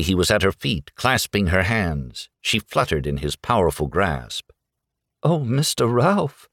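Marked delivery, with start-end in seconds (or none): 5.2-6.5 high, swooning Southern belle; fluttery high romance heroine; faint cry. A narrator's reading. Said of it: "she cried faintly".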